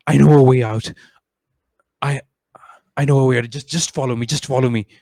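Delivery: urgent, loud NPC plea; urgent guide. Acted. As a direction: press them to follow you now. NPC Varun urging the party.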